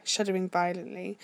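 plain reading voice

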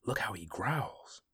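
soft breathless whisper; awed whisper. Acted. Watching men whisper around the fire, awed and soft. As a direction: hush it to the man beside you. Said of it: "ran the whispering around the fire".

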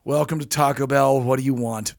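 low, bored, curt drive-thru voice; deadpan unwelcoming clerk; a hint of a surly clerk; flat rude greeting. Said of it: "He says it like that".

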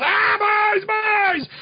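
high-pitched wail of agony, hammed up; mock pained cry. Peter blinded by the lights, mock pained wail. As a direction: cry out as if blinded, playfully overdone. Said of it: "Peter's eyes are still sensitive. He goes".

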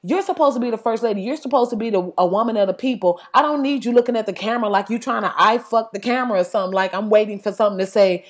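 usual speaking voice

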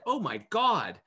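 high, loud, awestruck gasp of words; amazed exclamation. Retold or imitated. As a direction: blurt it out in wonder. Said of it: "And I was like".

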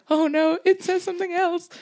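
high, squeaky mock panic; panicking that the tattoo is wrong. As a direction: gasp it out like sudden dread. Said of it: "that tiny moment of panic".

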